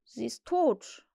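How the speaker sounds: slow, awkward statement; sheepish and slow; embarrassed. A narrator's reading. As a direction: say it haltingly, embarrassed. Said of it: "sagte er verlegen".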